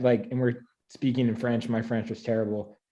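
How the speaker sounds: ordinary talk, nothing marked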